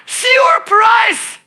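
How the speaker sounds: high, bursting mock shout; a gleeful high-pitched ambush yell; shouted surprise. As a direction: leap up high and yell it like a jump scare. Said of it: "kick the door open and shout".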